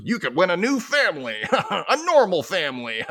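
hammed-up prize announcement, ending in cackle; game-show pitchman impression. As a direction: sell it like a cheesy sweepstakes host. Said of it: "He's like".

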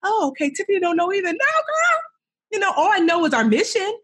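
very high, quick relieved voices; relieved team. Retold or imitated. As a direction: squeal like the team finally relaxes. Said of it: "to be like".

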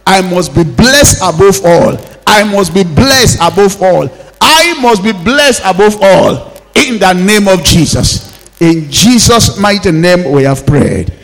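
loud, insistent preaching declarations; =forceful, ringing revival prayer; full-force prayer. Acted. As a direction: hammer out each declaration for the congregation.

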